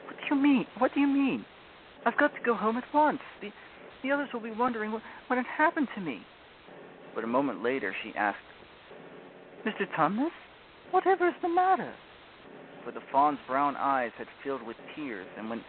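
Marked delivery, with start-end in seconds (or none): 0.0-6.2 high, urgent and anxious; frightened girl. A narrator's reading. Lucy alarmed, wants home. 9.6-11.9 high, concerned question; anxious young girl asking; worried girl. A narrator's reading. Lucy sees fawn crying.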